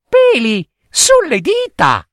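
very high mocking child voice with giggles; high sing-song teasing rhyme; teasing little girl. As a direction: taunt the monster cheekily. Girl's taunt.

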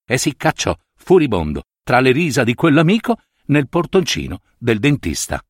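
ordinary delivery

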